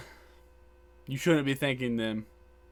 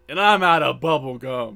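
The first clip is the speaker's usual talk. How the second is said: mic-drop punchline; 8 dB louder than usual. Retold or imitated. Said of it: "slams the mic down and goes".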